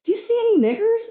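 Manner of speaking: high, loud pointed question; mysterious voice. Acted. Voice questioning him in the hotel, high loud challenge. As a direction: press the question loudly from on high. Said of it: "And the voice said".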